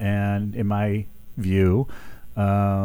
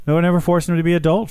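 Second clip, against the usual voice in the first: emphatic repeat; 7 semitones higher, 5 dB louder, much faster than usual. Real, not acted. Repeats point with force.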